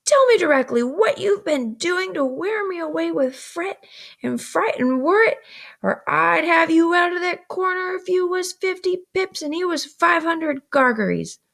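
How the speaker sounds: 甲高い声で叱りつける姉; high, sharp scolding sister's voice; scolding older sister. Acted.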